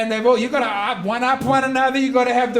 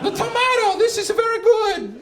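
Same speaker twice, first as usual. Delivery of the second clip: mock Italian; 9 semitones higher than usual. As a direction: play a boisterous Italian refusing the voyage. Italians praising new food, voiced.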